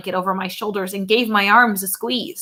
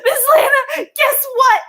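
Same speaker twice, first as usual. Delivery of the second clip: excited call; 6 dB louder than usual. A narrator's reading. Mo calling excitedly.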